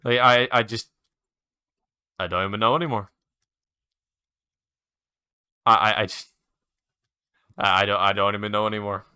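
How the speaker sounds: stammering, giving up mid-sentence; halting, defeated stammer with long gaps; lost for words. Real, not acted. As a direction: falter and restart, at a loss. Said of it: "I'm so lost for words".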